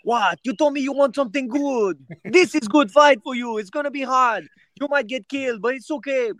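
squeaky put-on voice for the fight promoter; a shrill, high parody of the coach; imitating the coach. Thai coach answering the fighter.

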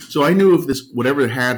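resuming the story, keyed up; flustered restart. Real, not acted. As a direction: jump back into the story. Resuming after laugh.

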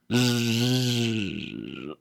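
quiet snoring breath; a dog's soft sleepy snore. Acted.

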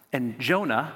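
usual speaking voice

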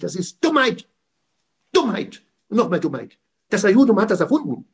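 high, loud, scornful outcry; furious preaching. Real, not acted. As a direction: hammer the word Dummheit home. (wütend und laut gepredigt)

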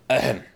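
a loud, deliberate ahem; a pointed ahem; throat clearing. Acted. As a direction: clear the throat to get attention. Maid clears throat before speaking.

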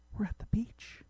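urgent whisper, worried someone hears; scolding whisper to her husband; hissed whisper. Acted. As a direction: hiss it under your breath. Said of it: "she hissed".

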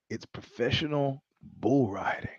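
unvoiced hushed reveal; hushed disbelieving whisper. Real, not acted. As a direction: whisper it like a shameful secret.

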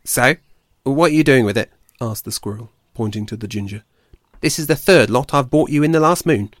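a fed-up forager venting; irritable squirrel. Acted. Ashford complaining to Blue Tongue about the badger, fed-up voice.

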